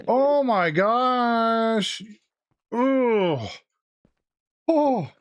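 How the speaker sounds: real fright, high cries building up; scared shriek. Real, not acted. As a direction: yelp in real fright at the Enderman. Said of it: "That literally scared me".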